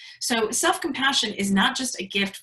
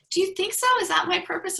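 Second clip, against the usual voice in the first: a high, wondering mom voice, imitated; mom's hopeful voice; 10 semitones higher, faster than usual. Daughter imitating her mom asking about her purpose, high and hopeful.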